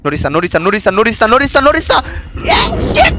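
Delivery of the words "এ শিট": startled shout. Real, not acted. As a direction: yell in shock. Caught again.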